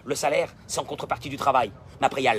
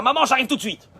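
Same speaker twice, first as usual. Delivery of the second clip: higher, louder call toward mother; eager son calling back; 8 semitones higher, 5 dB louder, faster than usual. Acted. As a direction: call back to Mom, ready to run over. Son answers his mother's call.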